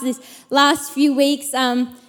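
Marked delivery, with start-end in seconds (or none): none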